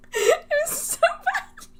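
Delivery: a high whine of embarrassment; high drawn-out wail. Real, not acted. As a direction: stretch it out in a high, mock-anguished wail. Reliving embarrassing talk.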